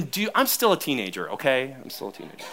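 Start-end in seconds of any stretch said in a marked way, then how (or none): 1.8-2.4 breathy whispered joke under the breath; whispered aside. Real, not acted. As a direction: whisper it as a sly confession to the congregation. Joking aside about being teenager.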